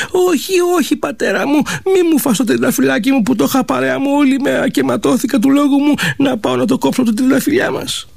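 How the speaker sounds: high, pleading pretend sob to her mother; girl faking tears to save the rose; fake crying plea. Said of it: "Έβαλε τα κλάματα η κοπέλα".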